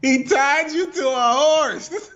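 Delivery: high, drawn-out laughing astonishment; laughing disbelief. Real, not acted. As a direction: stretch it out high, cracking up. Repeats the story in amazed laughter.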